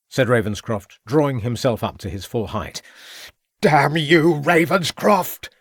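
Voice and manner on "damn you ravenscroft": high, loud, slow enraged curse; furious yell. A narrator's reading. Villain rushing at the inspector, a high furious yell. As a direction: hurl the curse as you charge. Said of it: "yelled Mountcourt".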